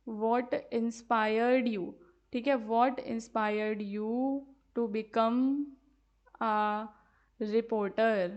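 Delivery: very slow, drawn-out words; slow dictation. Shown as a demonstration. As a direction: dictate the question for copying. Teacher dictates sample question.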